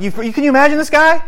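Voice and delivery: high, quick disbelief; acted-out astonished bystander; incredulous onlooker. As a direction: gawk at him in high-pitched amazement. Onlookers voiced, amazed at the man.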